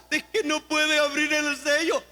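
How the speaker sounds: lloriqueo fingido y agudo; chillando. Acted. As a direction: imita a Juan lamentándose entre quejidos. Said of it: "Deja de estar chillando". (queja llorosa exagerada)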